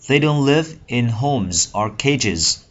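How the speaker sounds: slow, clear reading for learners; slow teacher read. Shown as a demonstration. Teacher reads a sentence slowly to Vietnamese learners, clear and unhurried. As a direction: read each word out slowly. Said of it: "English sentence read slowly to class".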